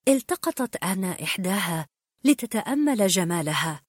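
ordinary delivery

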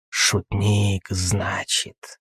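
quiet hissing creature. Acted. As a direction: hiss it out softly. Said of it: "прошипел в ответ Курули".

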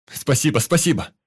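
high, loud panting thanks; breathless relieved shout. A narrator's reading. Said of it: "с одышкой кричал Ваня".

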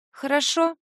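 tipsy, high rising okay; drunk. A narrator's reading. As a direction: agree sleepily, a bit drunk. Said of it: "Пьяно киваю я".

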